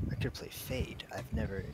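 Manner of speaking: soft, half-whispered musing; hushed murmur. Pretend. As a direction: murmur it under your breath, leave it unfinished. Joking half-sentences about agent picks.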